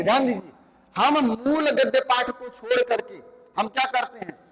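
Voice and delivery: usual speaking voice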